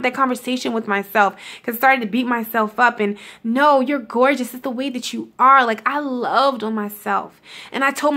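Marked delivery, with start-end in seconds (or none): none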